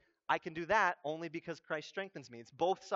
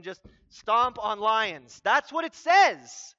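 mock insistence; 2 semitones higher, 9 dB louder, slower than usual. Preacher mocks literal readers of the lion verse, loud insistence. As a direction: punch it out like a foolish literalist.

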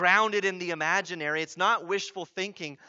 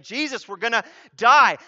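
loud, high mock panic; freaking out; 5 semitones higher, 13 dB louder than usual. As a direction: act out panicked disciples crying out. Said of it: "they're freaking out".

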